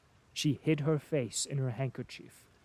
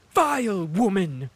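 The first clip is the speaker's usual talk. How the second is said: higher, loud shout; shouted insult; 6 semitones higher, 9 dB louder than usual. A narrator's reading. Princess shouts at Anna Mikhailovna, loud and high. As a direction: hurl it at her. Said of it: "shouted the princess".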